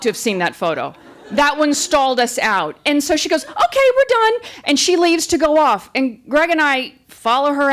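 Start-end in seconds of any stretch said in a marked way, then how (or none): 3.5-4.3 high cheerful clerk voice; perky and done with it; chirpy photographer. Retold or imitated.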